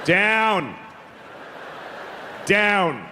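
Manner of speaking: firm "down", a touch above talking level. Shown as a demonstration. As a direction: say it just over conversational volume. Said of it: "at slightly above conversational volume".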